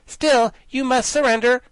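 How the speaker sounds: high, loud insisting command; fiercely insist, girl general. Acted. Ginger insists to the guardian, high fierce command. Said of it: "exclaimed the general fiercely".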